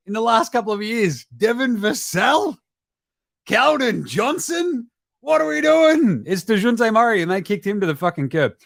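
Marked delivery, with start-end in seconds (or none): 1.4-4.9 slow, drawn-out disbelief; questioning each name slowly; incredulous. Real, not acted. Incredulous listing of players. 5.2-6.2 high, loud, drawn-out exasperation; high and loud what-are-we-doing; exasperated. Real, not acted. Exasperated rhetorical outburst.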